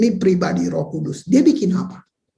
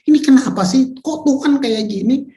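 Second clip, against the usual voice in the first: mock indignation; 5 semitones higher, 3 dB louder than usual. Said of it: "mereka marah semua ahli-ahli Taurat".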